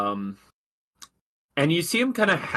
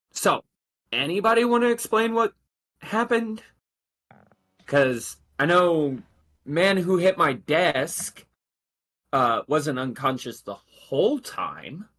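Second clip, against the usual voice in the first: higher, measured lieutenant's voice, slowing at the end; officer's voice; 6 semitones higher than usual. Acted.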